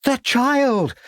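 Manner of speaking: high frantic cry; panicked cry. A narrator's reading. Lucy watching the pram go under, high panicked cry. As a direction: scream for the sinking baby.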